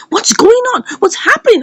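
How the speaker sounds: high, agitated mock confrontation; retold agitated demand. Madam Uchechi to the ally she paid, acted agitated demand.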